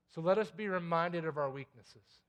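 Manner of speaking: ordinary delivery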